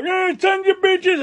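high, slow sneering voice; high, slow mock taunt; mocking old man.

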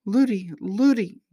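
put-on high, drawn-out child's plea; acted little girl's wail; crying out. A narrator's reading. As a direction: call slowly and piteously for the nurse. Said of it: "cried the princess".

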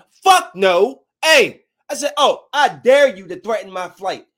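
loud retold outburst at the agent; angry, unchanged tone. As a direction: snap back loud, daring him. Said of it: "y'all thought I was gonna change my tone".

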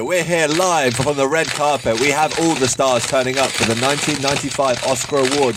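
bubbly, high live red carpet host; gushing red carpet chatter. Pretend. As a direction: gush like an excited TV presenter on the carpet. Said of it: "That's a very positive, uh, intro".

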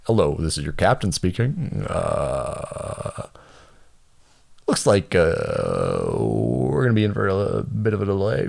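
drawling captain over the intercom; lazy pilot on the PA; pilot announcement. Retold or imitated. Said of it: "it goes".

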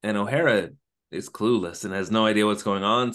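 ordinary delivery

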